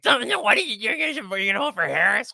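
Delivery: hammed-up high mock of a TV host; mocking Joy Behar. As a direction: sneer it in a high, nasal-free cartoon goblin squeal. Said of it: "With her fucking stupid goblin voice".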